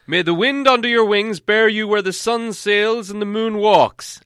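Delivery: put-on high, loud and formal old wizard; Gandalf. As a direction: give a solemn ceremonial reply. Gandalf answers the eagles.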